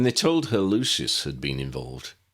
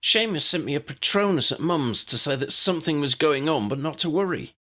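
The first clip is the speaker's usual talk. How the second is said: lightly voiced higher woman, worried; a hint of an anxious wife; Ginny's voice; 9 semitones higher than usual. Ginny explains to Harry, lightly higher voice.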